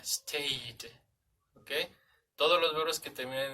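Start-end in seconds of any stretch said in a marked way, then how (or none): 0.0-0.9 "stayed" said as /steɪt/, the ed ending a crisp T sound; "stayed" ending in a clear T, like /steɪt/. Shown as a demonstration. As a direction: clip the end of "stayed" into a T. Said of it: "la pronunciación es como si fuera una T al final".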